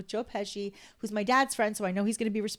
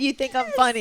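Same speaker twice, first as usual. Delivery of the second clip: louder mock-menacing "You think I'm funny?"; Goodfellas line replay; 5 semitones higher, 9 dB louder than usual. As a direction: deliver it like the famous movie threat. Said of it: "He played it back".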